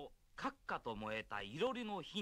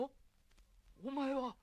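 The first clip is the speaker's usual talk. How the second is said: startled gasp; 9 semitones higher than usual. Acted. Junk dealer startled at night.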